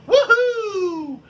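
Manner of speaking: high, laughing mock woohoo; fake-celebrate the awful stat; sarcastic cheer. Mocking his team's scoring with a sarcastic woohoo.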